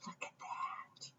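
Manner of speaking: barely audible whisper; hushed wonder. Real, not acted. Hushed aside at finished web.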